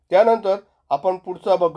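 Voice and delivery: ordinary talk, nothing marked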